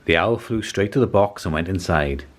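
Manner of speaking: ordinary delivery, nothing marked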